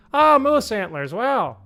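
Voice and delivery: high, loud put-on wonder; mock loud exclamation. Elderly woman commenting aloud mid-show, loudly amazed. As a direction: exclaim it full out like an elderly theatergoer. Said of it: "full out".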